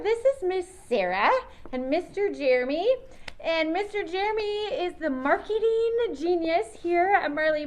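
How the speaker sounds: loud, bright presenter voice introducing guests; kids' show host. Acted. Host introducing the factory team to the kids, loud and upbeat. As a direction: project it like a children's TV host on location.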